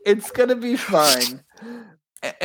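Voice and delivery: usual speaking voice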